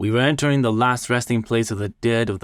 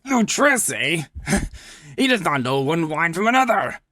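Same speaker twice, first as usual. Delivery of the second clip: much higher, quick scornful laugh at the rival; fast, high and scornful; mocking Fortunato; 9 semitones higher than usual. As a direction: dismiss Luchesi with a sneer. Fortunato mocks rival Luchesi.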